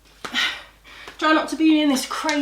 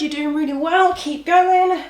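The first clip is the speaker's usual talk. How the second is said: loud, cheering urge; encouraging; 3 semitones higher, 6 dB louder than usual. Real, not acted. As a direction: cheer them on. Coach urging class on.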